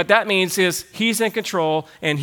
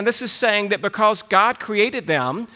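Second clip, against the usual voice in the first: ordinary delivery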